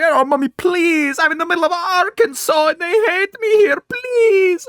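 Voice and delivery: very high, loud mock wail; hammed-up panicked begging; begging child. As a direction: plead desperately to mommy, stretching the words. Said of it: "and then you were like".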